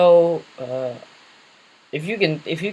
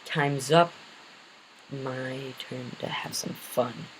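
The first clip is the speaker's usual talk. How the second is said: menacing Joker; 7 dB quieter, much slower than usual. Acted. Joker taps his watch and threatens, slow and soft. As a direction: draw out the villain's promise, menacing. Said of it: "and then he goes".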